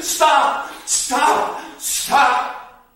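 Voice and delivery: high, loud desperate cries; hollering. Acted. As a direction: bang the walls and yell stop. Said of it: "and holler".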